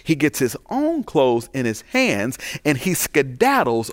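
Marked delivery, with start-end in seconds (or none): none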